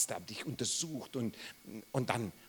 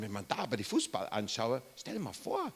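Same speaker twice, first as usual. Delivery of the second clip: ordinary talk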